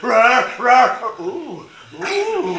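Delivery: loud roars melting into high happy oohs; roar, then coo with delight; contented lion. Acted. The lion enjoying his soapy bath, roars turning to happy oohs. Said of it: "So the lion went".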